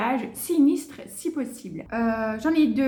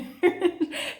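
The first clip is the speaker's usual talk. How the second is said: short airy laugh; quick happy laugh under the breath; breathy giggle; 6 semitones higher than usual. Real, not acted. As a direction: giggle with delight. Excited about the challenge.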